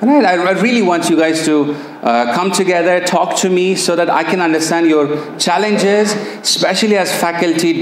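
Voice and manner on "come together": usual speaking voice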